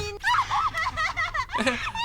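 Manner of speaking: high, shrieking crazed laugh; an unhinged schoolgirl's mad shrieking laugh; manic laugh. Acted. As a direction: cackle like she's lost her mind. Crazed girl laughing madly.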